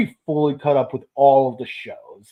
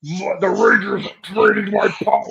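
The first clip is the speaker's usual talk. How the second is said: Astronema voice; 3 semitones higher than usual. Retold or imitated.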